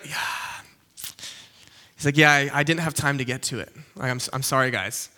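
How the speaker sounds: low, soft, trailing apology; acting the apologetic slacker; sheepish excuse. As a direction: mumble a guilty excuse, pitch dropped and sinking.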